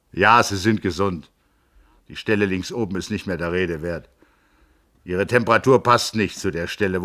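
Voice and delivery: a blustering physician giving his blessing; gruff doctor. A narrator's reading. The Hofrat sends Joachim off, hearty and loud.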